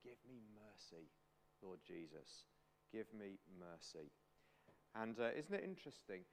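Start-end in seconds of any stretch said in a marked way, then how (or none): 0.0-4.1 low, very soft plea; hushed prayer. Acted. Bartimaeus praying to Jesus for mercy, low and hushed. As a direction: pray it humbly, barely above a murmur. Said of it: "so Bartimaeus prays for mercy".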